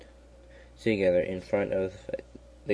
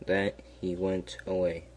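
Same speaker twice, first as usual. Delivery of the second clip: usual speaking voice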